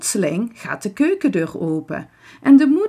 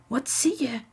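zachte, hoge nieuwsgierige vraag; gespannen zacht; 6 semitones higher, 5 dB quieter than usual. A narrator's reading. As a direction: vraag het gretig maar gedempt. Kinderen vragen gespannen, stil. (zacht en nieuwsgierig)

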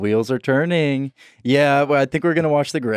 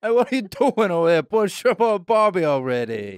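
fast, higher Aussie voice; Australian accent; 6 semitones higher than usual. Shown as a demonstration. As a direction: hurry them like an Aussie. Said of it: "put another shrimp on the barbie".

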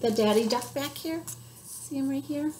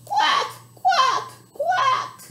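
duck call; 13 semitones higher, much slower than usual. Shown as a demonstration. Librarian showing kids how Papa Duck quacks. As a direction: model the quack for kids to copy. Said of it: "Can you help Papa Duck say quack".

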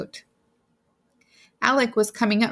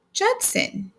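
sharp high cry of his name; shocked outburst at his name; startled exclamation; 12 semitones higher than usual. A narrator's reading. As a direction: call his name in shock, pitch leaping up. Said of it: "she exclaimed".

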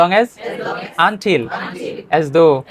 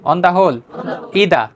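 ordinary talk

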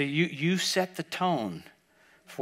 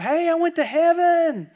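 high, loud, drawn-out shout; excited kid's scream; 13 semitones higher, 8 dB louder, slower than usual. Retold or imitated. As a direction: shout it like a child waking the house. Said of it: "he's screaming".

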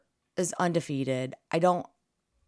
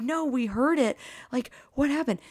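high, excited disbelief; incredulous reply; 9 semitones higher than usual. Retold or imitated. Telling bar friends they only heard it, high and excited. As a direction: answer in wide-eyed disbelief. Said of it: "We were like".

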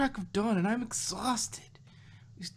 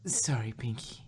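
whisper; 7 semitones lower, 3 dB quieter than usual. Acted. Fluttershy apologises timidly.

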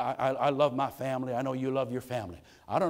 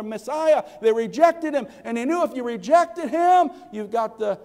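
much higher and louder, fervent build; full-force preaching; 9 semitones higher, 8 dB louder than usual. Acted.

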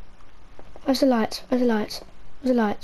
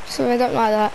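ordinary talk

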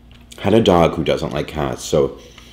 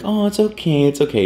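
high, sing-song reassurance to a scared kitten; soothing the shy cat; 13 semitones higher than usual. Retold or imitated. To a hiding kitten, high cooing reassurance. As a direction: coo it's okay gently to the cat. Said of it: "And we're like".

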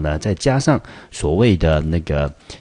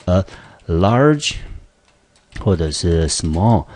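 slow emphatic model; much slower than usual. Shown as a demonstration. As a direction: punch "large", then stretch out "small". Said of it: "来，也是一样的".

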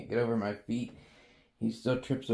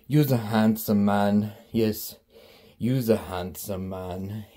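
low slow cooing; 6 semitones lower, 6 dB louder, slower than usual. Real, not acted. Cooing to pet.

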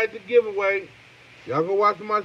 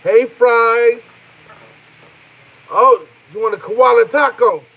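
loud, higher drive-thru worker call; mock fast food clerk; 4 semitones higher, 9 dB louder, slower than usual. Playing a cashier calling to a customer, loud and high. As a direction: play the cashier shouting the order back.

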